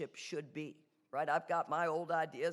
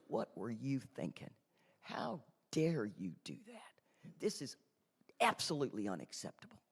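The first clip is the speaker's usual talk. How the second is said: voice of pride; 3 semitones lower, 5 dB quieter than usual. Retold or imitated. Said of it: "the voice that goes".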